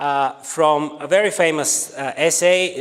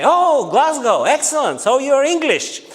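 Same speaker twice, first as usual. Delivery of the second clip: naive eager student; 11 semitones higher, 6 dB louder than usual. Retold or imitated. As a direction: play the clueless young Bulgarian, pitched way up.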